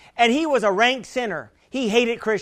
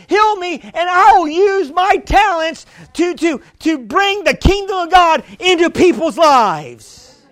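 higher, louder earnest plea to God; pleading prayer; 5 semitones higher, 4 dB louder than usual. Pretend. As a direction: pray it out loud, begging to be healed. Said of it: "and say".

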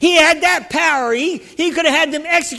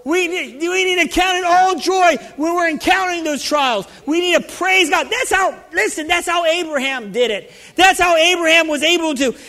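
full-force preaching; 3 semitones higher than usual. Acted. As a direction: drive every line home to the saints.

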